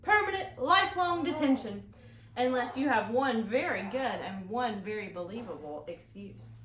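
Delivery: loud stern teacher voice; strict teacher. Acted. Teacher threatening Henry with detention, stern and loud. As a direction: lay down the law. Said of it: "said Missus Bug Scuffle".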